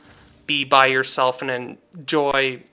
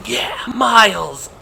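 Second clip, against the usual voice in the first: excited cheer; 7 semitones higher, 4 dB louder, slower than usual. Retold or imitated. Kid cheering at movie.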